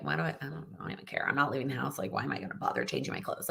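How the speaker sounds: whispered, worn-out grumbling to herself; exhausted, whispered self-talk; whispered tired complaint. Retold or imitated. Retelling her tired inner voice.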